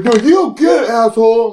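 put-on high, loud belligerent drunk; drunk heckler. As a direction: imitate an angry drunk hollering back. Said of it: "Just drunk as shit".

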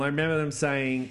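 usual speaking voice

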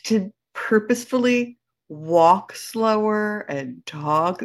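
very slow, drawn-out words; deliberately slow, savoring each word; slowed right down. Shown as a demonstration. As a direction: stretch it out as if practicing slowing down. Showing slowed-down walk and talk.

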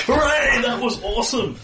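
high-pitched mock cheer; hooray like a delighted spectator; crowd cheering. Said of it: "They're shouting functionally".

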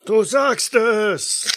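high-pitched cheering bystander; crowd heckler. Acted. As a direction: shout approval from the crowd. Crowd member agrees.

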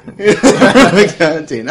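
slow laughing echo. Real, not acted. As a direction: repeat it through laughter. Laughing repeat.

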